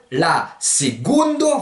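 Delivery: LA SECONDO slow, with g for c, "segoundo"; slow secondo, stressed "se-GOUN-do"; hard G. Shown as a demonstration. Teacher translating second, slow hard-G pronunciation. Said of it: "vous mettez un beau G".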